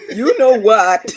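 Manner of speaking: loud, slow laughing retort; a loud laughing comeback to the teasing. Real, not acted. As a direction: fire back while cracking up.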